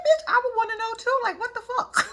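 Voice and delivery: outraged at a friend's rudeness; indignant outburst. Retold or imitated. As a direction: yell it up high in disbelief. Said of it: "I said".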